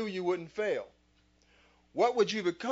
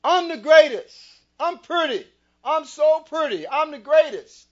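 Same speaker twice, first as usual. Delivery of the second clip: high, loud boxer's brag; shouted champion's boast to the crowd; Muhammad Ali boast; 8 semitones higher, 13 dB louder than usual. Retold or imitated. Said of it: "Muhammad Ali used to say".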